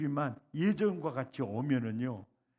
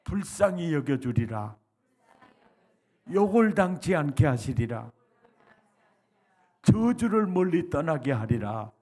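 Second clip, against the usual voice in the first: loud, declaiming recitation; preaching at full force; 9 dB louder than usual. Shown as a demonstration. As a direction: proclaim each line for the congregation to repeat. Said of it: "따라서 합니다". (회중이 따라하도록 힘차게 선포)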